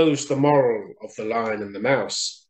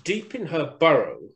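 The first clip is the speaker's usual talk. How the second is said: plain reading voice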